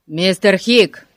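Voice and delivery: loud, slow hail; calling out. A narrator's reading. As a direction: call out to stop someone passing. Полицейский окликает хозяина мастерской. (громкий оклик)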